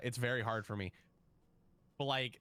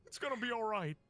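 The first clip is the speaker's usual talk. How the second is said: Shu voice; 10 semitones higher than usual. Shown as a demonstration. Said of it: "you're Shu from Dragon Ball".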